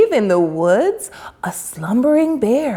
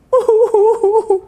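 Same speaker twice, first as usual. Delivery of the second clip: bright high giggle; high laugh; 11 semitones higher, 3 dB louder than usual. A narrator's reading. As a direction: break into a little laugh. Laugh after bear blubbers.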